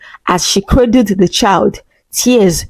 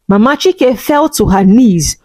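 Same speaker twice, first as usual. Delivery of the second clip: ordinary delivery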